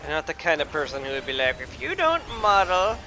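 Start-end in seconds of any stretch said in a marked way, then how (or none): 1.6-3.0 high, loud mock old-timey British outrage; old-timey British accent. Gamer mocking picky fans in an old-timey British accent, loud and high. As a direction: huff like an offended old-timey British gentleman. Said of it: "I don't know what this accent is".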